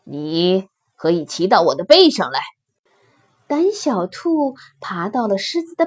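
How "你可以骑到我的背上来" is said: low-pitched lion making an offer; lightly voiced big lion, low; lion's voice. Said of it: "狮子蹲下身说". (低沉的狮子声音)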